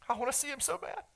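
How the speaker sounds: barely holding back tears; choked up. Real, not acted. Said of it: "get emotional when I see this".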